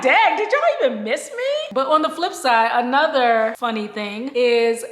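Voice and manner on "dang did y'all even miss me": playful high indignant. Retold or imitated. Retold reaction to twins.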